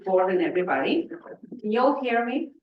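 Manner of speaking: ordinary talk, nothing marked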